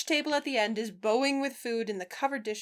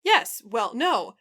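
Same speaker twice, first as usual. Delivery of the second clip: flustered; 3 semitones higher, 8 dB louder than usual. Acted. Dave flustered, blushing, stammering.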